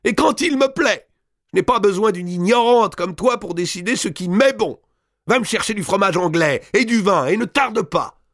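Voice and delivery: high, loud shrill commands; imperious countess. A narrator's reading. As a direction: order the maid about in fury. Countess keeps raging.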